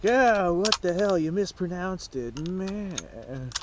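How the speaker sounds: parodying an annoyed viewer; mock critic. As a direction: sneer it like a know-it-all commenter. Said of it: "people will be like".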